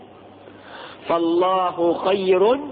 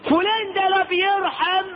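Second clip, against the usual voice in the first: mocking the saying; 10 semitones higher than usual. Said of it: "مش ده مثال؟".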